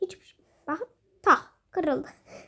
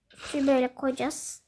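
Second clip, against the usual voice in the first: ordinary talk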